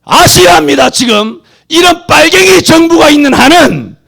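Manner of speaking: high, loud, shouting sermon; fiery preaching. Real, not acted. Preacher scolding megachurches at full force, shouting. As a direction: thunder at the flock, slow and hammering.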